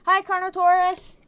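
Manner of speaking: high, loud hello; shouted greeting. Pretend. Player greeting the Carnotaurus, shouted greeting. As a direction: holler a greeting across. Calls out to the dinosaur.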